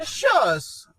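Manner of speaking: loud, high German farewell; playful, hammed-up German accent; mock German accent. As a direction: toss out a jokey German word. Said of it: "Do a German".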